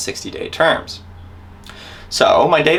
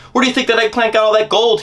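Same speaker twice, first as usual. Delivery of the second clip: high, loud raving rant; 10 semitones higher, 5 dB louder than usual. Retold or imitated. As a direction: rave at him, spitting mad. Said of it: "he raved".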